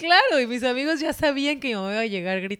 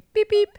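car horn imitation; 12 semitones higher, 8 dB louder than usual. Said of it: "las otras mamás llegaban de que".

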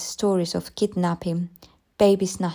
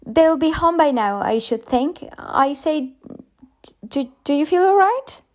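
put-on much higher, chirpy young teacher; cheerful and chatty, unaware of anything wrong; teacher gushing brightly to the mother; 9 semitones higher, 4 dB louder than usual.

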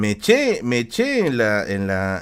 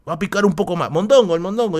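antojo entusiasta; 7 semitones higher, 5 dB louder than usual. Retold or imitated. El niño decide comer más.

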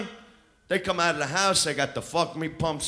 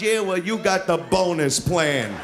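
normal talking voice

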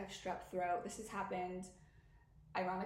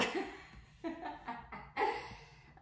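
laughing; 6 semitones higher than usual. Real, not acted. Reacting to listeners screaming.

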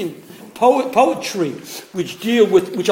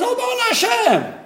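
high, drawn imitation of a shul cry; excited prayer cry; slower than usual. Teacher imitating the congregation in Hallel, excited cry. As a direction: throw the pitch up like a fervent crowd.

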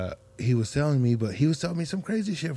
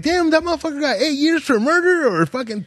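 much higher, louder stunned outburst; amazed disbelief; 13 semitones higher, 4 dB louder than usual. Retold or imitated. Watching First forty-eight, high and louder in shocked disbelief. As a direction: react like you can't believe the sentence. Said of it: "I'll be like".

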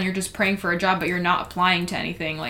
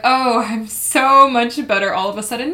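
exaggerated, louder gush of relief; hammed-up instant feel-better; mock sudden relief; 3 semitones higher, 6 dB louder than usual. Host mimicking someone waking up magically better, mock relief.

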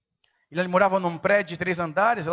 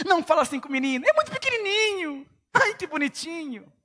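high, fast, mocking baby-talk mother voice; doting mother; 9 semitones higher than usual. Pastor mocking a mother who coddles her son, in high cooing baby talk. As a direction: mimic a coddling mom cooing over her boy. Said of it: "eu via como que as mães tratavam".

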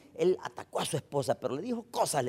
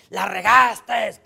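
drawn-out, louder, higher scolding yell; parent yelling; 7 semitones higher, 12 dB louder, much slower than usual. Acted. Father yelling at his child that he messed up.

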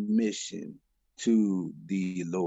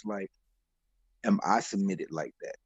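ordinary delivery

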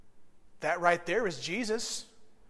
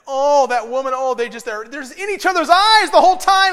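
much higher, much louder swoon; mock-swooning old man; 7 semitones higher, 13 dB louder than usual. Pastor retelling his old friend mocking a young couple, loud high swoon. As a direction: gush over young love, loud and high. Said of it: "everything he says is".

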